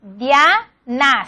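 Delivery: "Diana" as dia, pause, na; "Diana" said as two separate syllables; syllable division. Shown as a demonstration. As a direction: split "Diana" in two, keeping dia together. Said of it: "si fuéramos separar".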